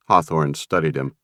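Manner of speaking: ordinary delivery, nothing marked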